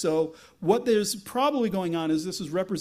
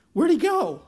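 much higher, louder puzzled call; mock bewilderment; 10 semitones higher, 6 dB louder than usual. Crowd looking for the missing Saul, mock bewildered. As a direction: call it out as a baffled searcher.

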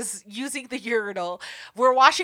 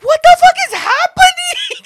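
much higher, much louder laughing shout; laughing disbelief; 12 dB louder than usual. Retold or imitated. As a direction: shriek it through laughter. Shocked by cage dancers, laughing.

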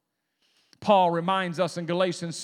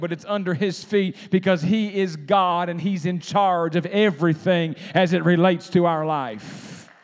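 fiery preaching; 4 dB louder than usual. Acted. Preacher telling his church how Jesus slept through the storm, rising preaching.

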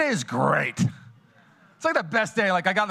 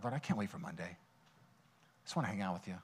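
soft tired prayer; 7 semitones lower, 14 dB quieter than usual. Retold or imitated. Quoting his tired prayer.

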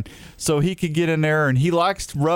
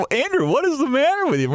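high-pitched, exasperated teasing; mock scolding; 11 semitones higher than usual. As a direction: playfully tell someone off, pitched way up. Teasing scolding of laughing Andrew.

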